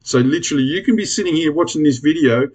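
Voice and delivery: ordinary talk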